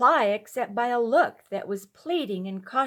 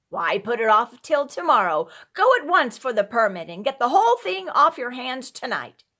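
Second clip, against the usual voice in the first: impatient urging; 3 semitones higher, 8 dB louder, faster than usual. A narrator's reading. Impatient wife pushing.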